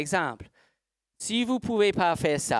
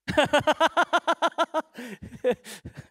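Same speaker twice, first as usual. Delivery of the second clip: high, rapid burst of giggles; long real laughing fit, high-pitched; amused laugh; 10 semitones higher than usual. Real, not acted. Teacher cracks up hearing someone copy the accent, high giggling.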